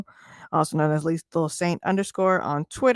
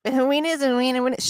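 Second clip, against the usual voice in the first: higher-pitched mimicry of smug fans; mocking imitation; 6 semitones higher than usual. Host imitating people defending a narrow win, mocking. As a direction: parrot the fans in a raised mock voice. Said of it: "For those people like".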